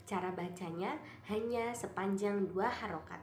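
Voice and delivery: ordinary talk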